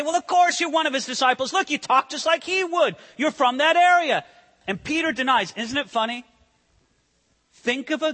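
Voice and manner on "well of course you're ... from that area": high, mock accusing voice; accusing bystander. Preacher voicing bystanders who catch Peter's accent, high and accusing. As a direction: point the finger, pitched up.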